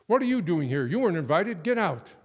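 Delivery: loud, indignant, high outburst; angry host. Retold or imitated. Host ordering his enemy out of the party, angry shout. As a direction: snap at the unwanted guest to leave.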